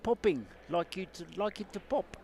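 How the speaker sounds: put-on botched Dutch accent, drifting South African; high, loud put-on Dutch accent. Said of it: "I was trying to do a, a Netherlands accent there".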